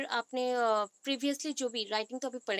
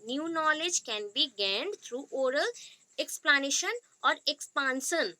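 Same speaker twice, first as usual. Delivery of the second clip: each word drawn out slowly for note-taking; slow dictation; much slower than usual. Shown as a demonstration. Teacher dictating a definition to students, very slow.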